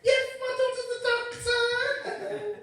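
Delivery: high, slow, eager proud mum calling out; a proud mother announcing loudly and slowly; shrill proud mum. Retold or imitated. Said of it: "My mum went".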